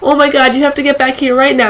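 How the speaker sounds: frantic, high, rushed; freaking out on the phone; panicked emergency call. Retold or imitated. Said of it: "I get this frantic phone call from Heather".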